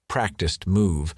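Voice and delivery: ordinary delivery, nothing marked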